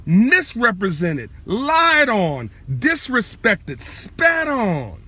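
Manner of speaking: slow, emphatic sermon delivery; dramatic preaching. Real, not acted. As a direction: stretch each hard word and pause after it. Preaches the crucifixion in drawn-out phrases.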